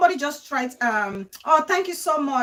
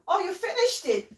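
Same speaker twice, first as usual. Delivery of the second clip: delighted surprise; 7 semitones higher than usual. Real, not acted. Child finished something.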